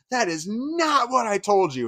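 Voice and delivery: high, slow, emphatic mock fury; drawn-out, high-pitched scolding; enraged. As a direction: imitate an enraged woman charging in. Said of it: "she was enraged".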